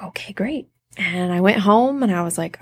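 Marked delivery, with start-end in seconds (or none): 0.0-0.7 whispered. Retold or imitated. Deflated reply to rejection news.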